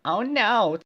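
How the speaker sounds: high, loud cry of "oh no"; cried. Acted. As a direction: squeal it in dismay. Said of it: "Twilith cried".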